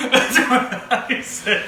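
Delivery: slow laughing high. Real, not acted. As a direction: laugh through the words. Laughing at the slip.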